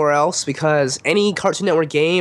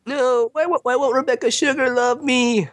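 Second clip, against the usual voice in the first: mock heartbroken whine; 8 semitones higher than usual.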